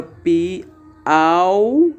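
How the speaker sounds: teacher separating Piauí syllable by syllable; syllables split apart. Shown as a demonstration. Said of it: "Repare que a palavra".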